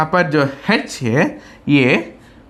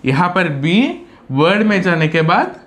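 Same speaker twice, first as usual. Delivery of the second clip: ordinary talk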